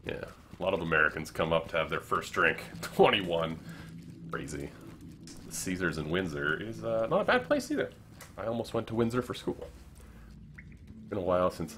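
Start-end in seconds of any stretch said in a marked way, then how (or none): none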